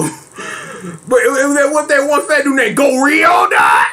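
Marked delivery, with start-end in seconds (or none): none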